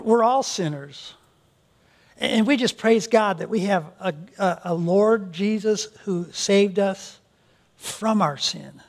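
ordinary talk

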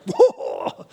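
a sudden high startled cry; startled whoa. Retold or imitated. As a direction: jolt awake in alarm, voice jumping up high. Startled shepherds waking up.

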